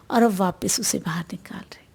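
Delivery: soft whisper on the out-breath; whispered, fast breathing cue. Acted. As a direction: breathe the words out in a hush. Guiding the out-breath.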